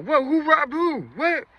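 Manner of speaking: high-pitched, baffled outcry; imitated startled confusion. Party dudes hearing of a robbery, imitated high and startled. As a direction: react like you just heard the alarm.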